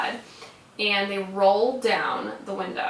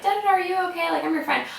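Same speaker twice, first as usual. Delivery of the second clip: high-pitched roommate imitation; worried roommate; 9 semitones higher, much faster than usual. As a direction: mimic a friend checking on someone, high-voiced. Imitating roommate's concerned question.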